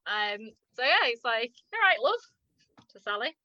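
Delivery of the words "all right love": higher-pitched northern English greeting; northern English accent. Retold or imitated. Him greeting Sally, lifted northern English accent. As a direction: lift it lightly in a northern accent. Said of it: "in his northern accent".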